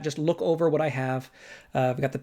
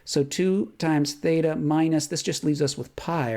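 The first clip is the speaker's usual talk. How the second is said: ordinary delivery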